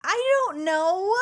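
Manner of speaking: high, drawn-out, loud bewilderment; baffled. Real, not acted. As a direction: stretch it slowly upward, half laughing. Stumped by the odd nickname.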